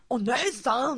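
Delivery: high, loud imitation yell; Nyanchu impression. Co-host describing a voice actor's skill, Nyanchu impression. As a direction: mimic a cartoon shout of big sister. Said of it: "にゃんちゅうのモノマネ".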